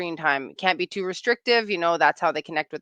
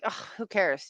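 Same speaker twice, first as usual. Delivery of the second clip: dismissive; 7 dB quieter than usual. Retold or imitated. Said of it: "or being like".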